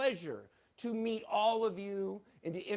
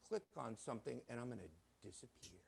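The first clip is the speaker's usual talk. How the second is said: fading away; 9 semitones lower, 16 dB quieter, much faster than usual. Pretend. Magician fading out.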